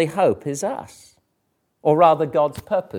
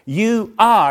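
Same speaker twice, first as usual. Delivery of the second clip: "You" pitched high and pressed; emphatic "You"; 5 semitones higher, 4 dB louder than usual. Shown as a demonstration. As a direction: hit "You" hard, then fall on "are". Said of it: "the emphatic "you" starts each phrase".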